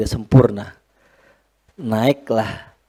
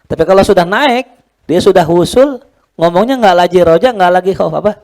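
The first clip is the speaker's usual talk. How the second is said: rising preacher emphasis; 6 semitones higher, 6 dB louder, faster than usual. Acted. Preaching climb.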